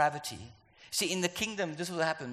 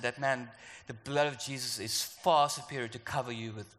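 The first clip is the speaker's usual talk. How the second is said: ordinary talk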